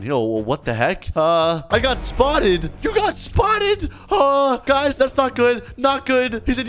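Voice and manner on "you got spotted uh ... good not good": alarmed. Real, not acted. Panicked reaction to being spotted.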